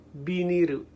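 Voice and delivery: "Biniru" as bi-ni-ru with tapped r; Japanese pronunciation. Shown as a demonstration. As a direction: demonstrate "Biniru" with Japanese vowels. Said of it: "It's pronounced".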